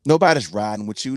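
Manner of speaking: usual speaking voice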